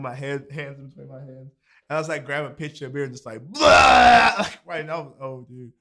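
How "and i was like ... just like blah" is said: fast, loud fake vomiting blurt; hammed-up throw-up noise; mock retching. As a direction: act out puking with a loud gag. Retelling throwing up at a party.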